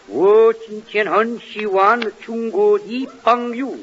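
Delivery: slow, singsong mock Chinese gibberish; drawn out fake Chinese, each syllable stretched. Shown as a demonstration. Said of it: "in Chinese it would go".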